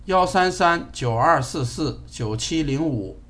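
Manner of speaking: slow, clear digit by digit; slow dictation. Real, not acted. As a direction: spell out each digit slowly. Dictating number to device.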